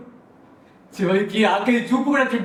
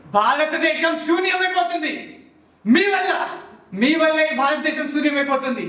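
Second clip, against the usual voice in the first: impassioned accusation; 4 semitones higher than usual. Acted. Accusing students of emptying India. (ఆవేదనతో నిందిస్తూ)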